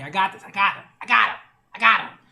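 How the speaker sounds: loud mock tough defender, building in force; rallying defiance. New Yorkers step in for Spider-Man, loudly defiant.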